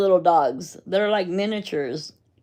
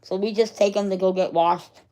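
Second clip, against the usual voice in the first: ordinary talk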